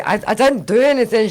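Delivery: high, loud mock-innocent protest; innocent denial. Mum caught by daughter, loud innocent denial. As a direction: play dumb, voice up and loud.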